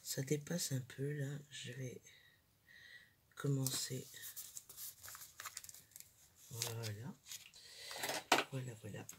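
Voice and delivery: talking very softly, hushed; library whisper. Real, not acted. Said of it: "je parle tout bas". (voix très basse, chuchotée)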